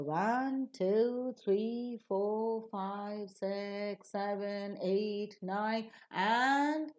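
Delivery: each number from ONE to NINE lifted and held; suspended rising count, waiting for the last. Shown as a demonstration. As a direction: count upward, every number left open through nine. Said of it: "all the counts will receive a rising tone".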